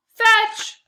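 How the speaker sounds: high, loud command to the dog; yelled. A narrator's reading. Boy yelling at his Labrador to fetch the stick, loud and high. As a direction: yell it out to send him running. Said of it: "I yelled".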